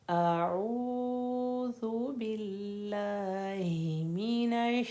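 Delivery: whole ta'awwuz chanted very slowly; slow Quran recitation. Shown as a demonstration. Teacher reciting the full line for students: slow chanted tajweed. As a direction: recite it slowly, every letter clear. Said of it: "दोबारा से फिर कोशिश करते हैं".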